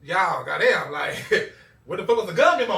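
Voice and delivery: loud, high laughing protest; laughing loudly at being left out; laughing disbelief. Retold or imitated. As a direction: laugh it out in mock outrage. Laughing at brothers' guns.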